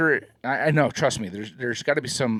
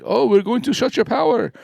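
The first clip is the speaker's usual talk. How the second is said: high mock caller voice, pitched up; mock scam caller; 11 semitones higher, 4 dB louder than usual. Mocking the scam caller's threat to a friend, high and pushy. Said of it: "they were like".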